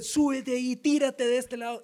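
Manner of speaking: un poco más agudo, tentando; Satanás tentador. Acted. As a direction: como el diablo que provoca con sorna. Satanás tienta a Jesús. (Satanás tentando a Jesús, algo más agudo)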